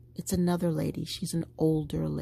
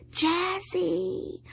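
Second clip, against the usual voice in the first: very high, drawn-out cheer of a name; excited call-out. Real, not acted. Vlogger naming creators they watch, excited high call-out. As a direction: sing it out high and long.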